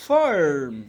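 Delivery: ফার্ম as "faarrm", r held; slow American-style ফার্ম; American pronunciation. Shown as a demonstration. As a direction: curl and hold the r in ফার্ম. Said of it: "আমেরিকানরা বলবে".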